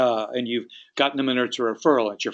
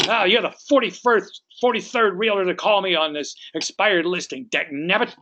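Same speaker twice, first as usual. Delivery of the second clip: high, exasperated complaint; angry, frustrated; 6 semitones higher than usual. Acted. Homeowner with expired listing to agent, angry and fed up. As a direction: vent at one caller too many. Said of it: "they're angry, they're frustrated".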